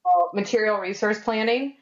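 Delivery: ordinary talk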